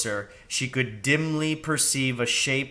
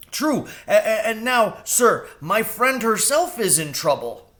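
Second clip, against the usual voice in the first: high stammering crow; nervous crow; 10 semitones higher, 3 dB louder than usual. Acted. Crow to owl.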